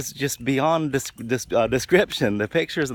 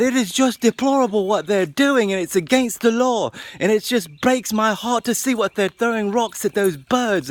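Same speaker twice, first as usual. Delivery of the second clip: put-on British accent; 9 semitones higher, 3 dB louder than usual. British lady scolds parents over kids throwing rocks, high mock outrage. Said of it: "she was just yelling and yelling".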